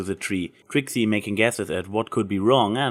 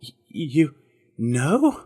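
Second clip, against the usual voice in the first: stammering, a touch higher, flustered; squeaked out; 5 semitones higher, much slower than usual. A narrator's reading. Trixie flustered when Applejack guesses her feelings, stammering squeak. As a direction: trip over the words, caught off guard. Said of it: "Trixie squeaked out".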